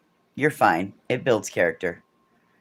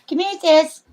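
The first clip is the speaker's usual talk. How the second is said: high sing-song call. Real, not acted. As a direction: coax the dog over in a high, bright call. Calling the dog over.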